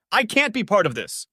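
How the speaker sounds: high-pitched, heated protest; agitated yell. A narrator's reading. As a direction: yell it, refusing to be involved. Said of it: "he yelled".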